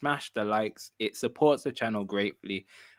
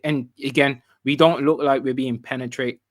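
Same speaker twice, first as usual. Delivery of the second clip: ordinary talk, nothing marked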